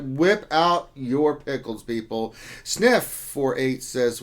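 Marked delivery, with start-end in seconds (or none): 0.0-1.8 slow, louder, put-on proclamation; mock announcer line. Streamer hamming up a viewer's joke for chat, slow and loud. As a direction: declare it grandly to the chat.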